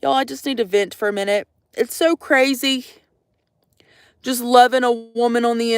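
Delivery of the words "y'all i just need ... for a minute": normal talking voice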